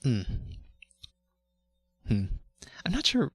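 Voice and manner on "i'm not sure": breathy high head voice; high falsetto. Shown as a demonstration. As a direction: float it up in falsetto. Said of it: "this one's going in the voice bag".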